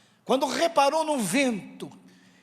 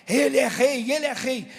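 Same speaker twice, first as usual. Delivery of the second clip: louder, chanting crowd cry; crowd acclamation; 2 semitones higher, 6 dB louder than usual. Acted. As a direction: acclaim him as an excited crowd. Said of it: "o clima da multidão é".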